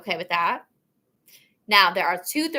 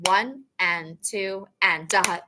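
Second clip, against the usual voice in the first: slow, deliberate clap-count; teacher leading a slow count; slow counting demo; slower than usual. Shown as a demonstration. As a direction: count each beat slowly and clearly. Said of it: "clap and count".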